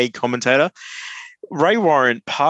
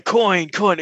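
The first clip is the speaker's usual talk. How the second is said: higher-pitched commentator impersonation; raised-pitch mimicry of an iconic sports caller; Ray Warren; 6 semitones higher than usual. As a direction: lightly mimic an excited rugby league commentator. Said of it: "trying to do a Ray Warren impersonation".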